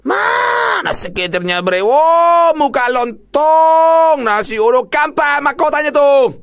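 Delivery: high, loud, drawn-out shout at the game; excited yell. Real, not acted. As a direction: yell at the screen in delight. (teriakan heboh)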